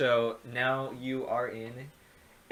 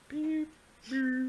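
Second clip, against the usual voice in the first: beeping noise; 13 semitones higher than usual. Pretend. GM makes scanner sound effect.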